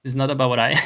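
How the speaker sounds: loud, jokey outburst; playful loud shutdown; mock telling-off. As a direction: snap loudly over the chatter, half in jest. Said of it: "And I'm like".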